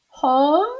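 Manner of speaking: high, rising surprised echo; surprised Amelia. Acted. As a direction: echo it, startled. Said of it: "said Amelia Bedelia".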